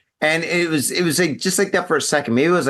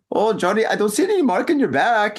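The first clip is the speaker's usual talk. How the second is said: put-on weak German accent, slightly high; a bad, half-hearted German accent; 8 semitones higher than usual. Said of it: "that's my German accent. It's terrible".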